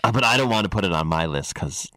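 low put-on auctioneer voice; lightly voiced auctioneer. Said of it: "Why are you doing the auctioneer voice?".

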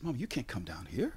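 urgent whisper to mom; embarrassed hush. Acted. Player whispers to his mother on the team bench, embarrassed hush. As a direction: whisper it so the team won't hear.

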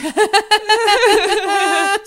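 long high nervous laugh; nervous fake laugh. Shown as a demonstration. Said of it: "sabe aquela risada".

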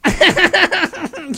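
pleased laugh after the punchline; chuckle at own joke. Acted.

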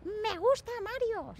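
high, slow put-on voice; mock quoted voice. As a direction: stretch it out in a high silly voice. Quoting someone in a put-on voice.

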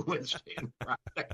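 words breaking into laughter; laughing. Real, not acted. Doctor joking about fathers' pickup days, laughing. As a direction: lose it laughing as you finish the list. Laughing at his own joke.

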